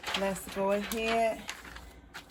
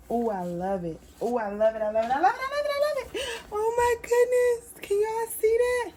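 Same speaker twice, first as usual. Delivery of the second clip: rising higher and louder with each I love it; building delight; 13 semitones higher, 10 dB louder than usual. Real, not acted. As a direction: build from pleased to ecstatic. Thrilled with her finished piece.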